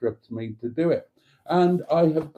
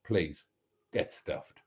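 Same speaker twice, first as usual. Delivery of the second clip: quiet; 6 semitones lower, 8 dB quieter, slower than usual. Pretend. Mock rude sign-off.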